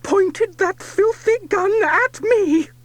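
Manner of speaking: loud, high, slowing furious lady; furious shout. A narrator's reading. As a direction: shout the accusation in fury. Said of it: "and shouts".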